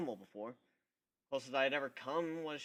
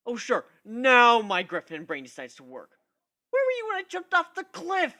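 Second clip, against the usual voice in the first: sarcastic; 9 semitones higher, 12 dB louder than usual. A narrator's reading. Sarcastic aside to own brain.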